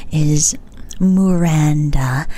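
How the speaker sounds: normal talking voice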